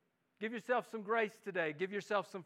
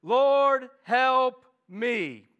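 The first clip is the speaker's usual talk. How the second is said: loud desperate plea, Lord drawn out; pleading cry; 4 semitones higher, 12 dB louder, much slower than usual. Acted. Mother crying out to Jesus for her daughter, loud and pleading. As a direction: cry out Lord, help me loudly. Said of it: "pleading again".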